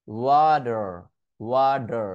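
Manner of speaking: ওয়াটার demo with flapped d sound. Shown as a demonstration. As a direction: flap the t in ওয়াটার like a quick d. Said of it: "flap টিতে convert করে আমি বলতে পারি".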